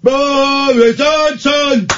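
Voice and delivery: high, very slow stretched-out cry; drawn-out hailing call. Acted. Host heralding Boris Johnson, long high hailing call. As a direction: call the name out high, dragging every vowel. Host hails the Prime Minister.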